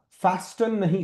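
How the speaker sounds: ordinary talk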